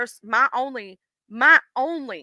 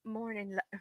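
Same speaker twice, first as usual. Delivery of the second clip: deepened voice; 17 dB quieter than usual. Pretend. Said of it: "Let me see if I can deepen my voice".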